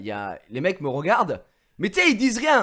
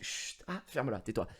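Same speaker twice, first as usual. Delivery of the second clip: hushing; 2 semitones lower, 8 dB quieter than usual. Retold or imitated. Hushing himself mid-story.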